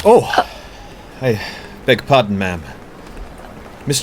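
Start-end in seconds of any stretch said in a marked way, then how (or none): 0.1-0.5 sudden high, loud exclamation of surprise; startled oh. Acted. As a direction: jump at the unexpected meeting. Bumps into Jo in the rain.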